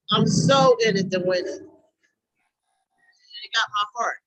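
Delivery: choked up, words dragging out slowly; real emotion welling up mid-sentence; emotional. Real, not acted. Member telling the group what moves her, emotional. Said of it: "this brings the emotions out of you".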